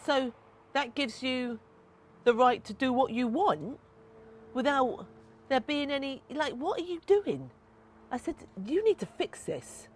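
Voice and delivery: retold heated confrontation. Confronting dad over son's loyalty.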